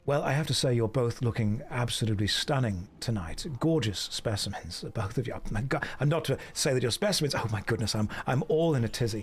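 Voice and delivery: mock-charming posh Englishman chatting up ladies; a hint of a plummy English gent; posh English accent. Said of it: "They like the Hugh Grant English accent".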